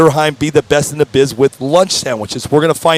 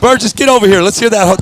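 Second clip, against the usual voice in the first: calling out; 6 semitones higher than usual. Real, not acted. Calling the next guest over.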